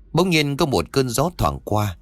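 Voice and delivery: plain reading voice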